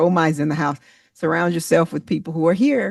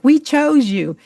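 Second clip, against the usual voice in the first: jumps high and louder, thrilled; excited quote; 11 semitones higher, 4 dB louder, slower than usual. Retold or imitated. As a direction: say it like the stars are picking you out of the crowd. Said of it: "they're like".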